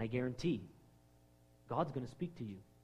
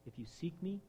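soft voice of God; 4 semitones lower, 11 dB quieter than usual. Acted. God speaking softly.

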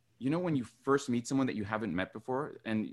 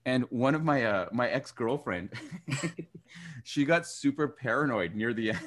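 usual speaking voice

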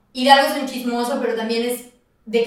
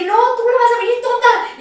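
high, insistent mock scolding; urgent self-scolding; 10 semitones higher, 5 dB louder than usual. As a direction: scold yourself into action, pitched up. Retells scolding herself into action. (regaño urgente actuado)

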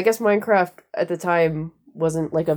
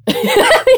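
high burst of laughter; 7 dB louder than usual. Real, not acted. Laughing at shuffling.

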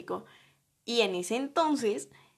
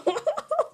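very high, short giggle that rises; high squeal of laughter. Real, not acted. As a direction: let a squeaky laugh escape. Laughing at her own excuse.

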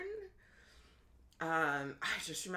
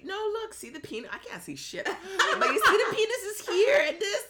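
much higher, excited, laughing by the end; eager ultrasound tech; 10 dB louder than usual. Retold or imitated. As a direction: imitate the tech pointing at the screen. Ultrasound tech shows the baby.

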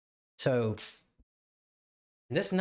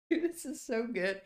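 high, laughing through the words; real laughter; 13 semitones higher than usual. Real, not acted. Podcaster cracking up at his own fight story, laughing. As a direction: struggle to talk through a laugh. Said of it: "that's why I'm laughing right now".

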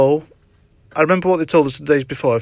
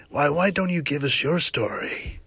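softer, hushed imitation of a calm show host; presenter's soft voice; 6 dB quieter than usual. Podcaster mimics the Dogman show host inviting a guest, softly. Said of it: "He's like that, the way he talks".

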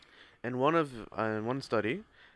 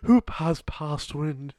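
put-on slow, louder British accent, each word drawn out; mock British accent; 3 semitones higher, 5 dB louder, much slower than usual. Mocking the polite phrase in a British accent. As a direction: demand it like a pompous English lord. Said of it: "why are you speaking in a British accent".

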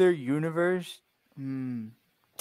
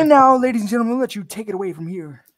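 announcer voice; 8 semitones higher, 6 dB louder, faster than usual. Pretend. Hosting announcement intro.

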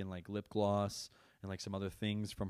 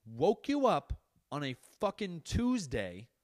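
angry rant; 6 semitones higher, 5 dB louder than usual. Retold or imitated. Dad ranting to his son about going back to Puerto Rico, exasperated and loud. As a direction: vent at the kid like it's happening again.

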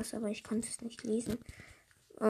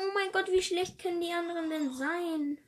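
exasperated; 7 semitones higher than usual. Real, not acted. Angry at teammates.

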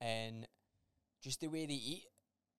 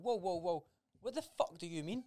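higher, incredulous retold outburst; shocked disbelief; 6 semitones higher than usual. Uncle retelling his reply to his niece, incredulous and raised. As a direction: replay the moment the niece's remark floored you.

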